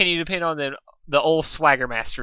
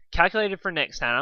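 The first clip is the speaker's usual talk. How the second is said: plain reading voice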